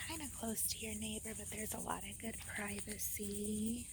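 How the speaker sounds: soft hushed campsite talk; whispering. Real, not acted.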